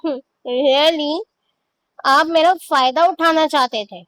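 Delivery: bitter, mocking disbelief; bitter scoff. A narrator's reading. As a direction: sneer the question at him. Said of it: "تلخی سے ہنس دیا".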